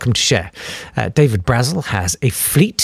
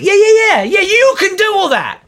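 high, louder mocking yeah yeah; sarcastic; 4 dB louder than usual. Podcaster scoffing at rich TV landlords, high sarcastic voice. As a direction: scoff at them, pitched way up.

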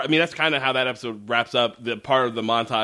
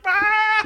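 wheezy laugh; 4 dB louder than usual. Retold or imitated. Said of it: "He's doing that laughing where it's like".

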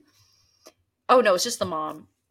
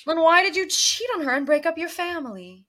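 mock indignant question; 9 semitones higher, 4 dB louder than usual. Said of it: "It's like".

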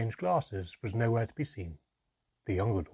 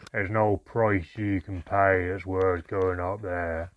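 slow, low, rough dwarf voice; gruff, ominous dwarf; grunting dwarf; 3 semitones lower, 5 dB louder than usual. A narrator's reading. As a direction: warn her off slowly in a gruff grunt. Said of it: "grunted the first dwarf".

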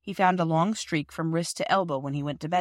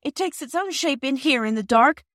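high, loud frightened shout; a terrified girl shouting about a dragon; 8 semitones higher, 5 dB louder than usual. A narrator's reading. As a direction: scream it over the train. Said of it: "shouted Phyllis".

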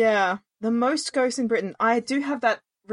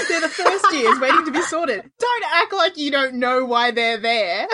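laughing; 5 semitones higher, 4 dB louder than usual. Real, not acted. Laughing at the ghosts' act.

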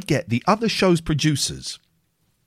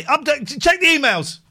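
talking to Google; 11 semitones higher than usual. Retold or imitated. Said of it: "and say".

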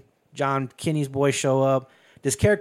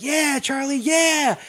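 high, loud whoop of encouragement; hammed-up excited holler; mock cheering; 11 semitones higher, 4 dB louder, slower than usual. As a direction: egg on a partner in a gunfight. Billy cheers on Charlie's shooting.

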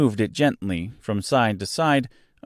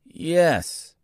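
higher, mournful Tin Man; sad; 7 semitones higher than usual. A narrator's reading. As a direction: answer in a sad, raised voice. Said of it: "the sad voice in which the man spoke".

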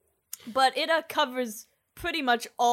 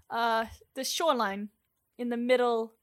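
ordinary talk, nothing marked

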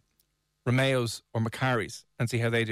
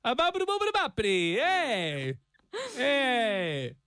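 much higher cartoon Italian gibberish; hammed-up Italian; 10 semitones higher, slower than usual. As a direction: ham up a silly Italian. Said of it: "to become more Italian".

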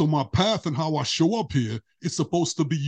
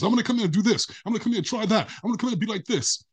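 eager spirits; 6 semitones higher, faster than usual. Retold or imitated. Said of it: "we all want to come here and be different things".

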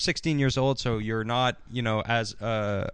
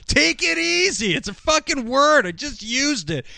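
mock matched energy; 12 semitones higher, 3 dB louder than usual. [name] matching Andrea's freak-out, high mock shout. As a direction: throw her energy right back, pitched way up. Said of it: "matches her energy".

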